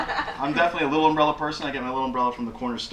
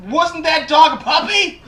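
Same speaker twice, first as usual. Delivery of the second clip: loud, high, slow blurt; awkward neighbor's strained outburst; bungling awkward neighbor; 14 semitones higher, 8 dB louder, slower than usual. Acted. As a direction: force the question out far too loud and high. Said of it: "bungling, you know, trying to get words out".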